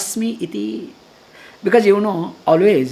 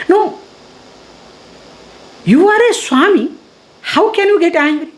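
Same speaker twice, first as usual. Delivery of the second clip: retold indignant rebuke; 8 semitones higher, 5 dB louder than usual. Retold stranger rebuking the swami.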